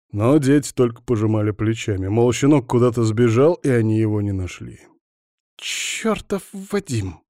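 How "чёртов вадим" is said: high, drawn-out female exasperation; freaking out. A narrator's reading. Alina cursing Vadim over Bogdan's shoulder, freaking out. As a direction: curse him slowly in a high voice. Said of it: "принялась психовать Алина".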